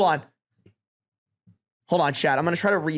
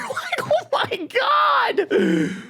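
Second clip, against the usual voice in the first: very high, slow laughing squeal; hysterical disbelief; much slower than usual. Real, not acted. Streamer picturing the plus one's shock, squealing laugh. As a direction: squeal it out through helpless laughter. Overwhelmed by imagining the speech.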